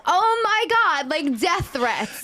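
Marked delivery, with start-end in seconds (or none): 0.1-1.1 shocked. Retold or imitated. Retelling shock at death threats.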